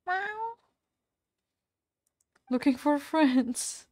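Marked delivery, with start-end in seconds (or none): 0.1-0.6 single high rising mew; high pretend kitty meow; cat meow. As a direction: play at being a kitten. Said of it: "I am on my cat form today".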